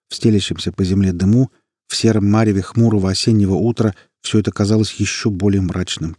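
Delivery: plain reading voice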